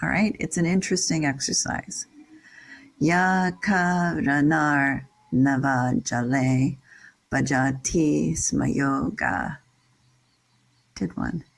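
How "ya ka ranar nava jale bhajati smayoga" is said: slow metrical "Ya ka ranar", long syllables held; sing-songy Sanskrit. Shown as a demonstration. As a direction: recite "Ya ka ranar nava jale" slowly, syllable by syllable. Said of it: "sing-songy Sanskrit".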